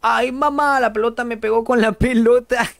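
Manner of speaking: mock whimpering child voice, lightly done; imitating Kiko crying. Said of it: "Kiko llorando". (imitación ligera de Kiko llorando, más aguda)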